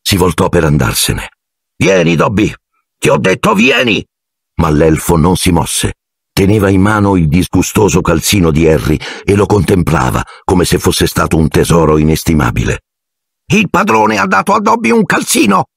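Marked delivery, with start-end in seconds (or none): 1.8-4.0 high, insistent order, rising on the repeat; impatient command. A narrator's reading. Malfoy orders Dobby to follow him, impatient command. 13.5-15.7 very high, squeaky elf voice, overjoyed; Dobby, ecstatic. A narrator's reading. Elf marvels at the master's gift of a sock, ecstatic. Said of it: "disse l'elfo estasiato".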